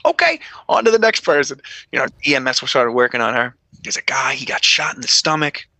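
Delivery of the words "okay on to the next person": high, sing-song moving on; breezy dismissal. Retold or imitated. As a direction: shrug it off in a high, light voice. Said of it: "I was like".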